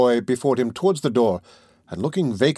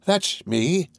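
cried out; 6 semitones higher than usual. A narrator's reading.

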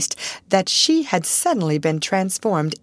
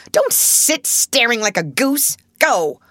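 higher, louder, scolding; 7 semitones higher, 4 dB louder than usual. A narrator's reading. Miss Minchin to her sister, scolding. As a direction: drive her out.

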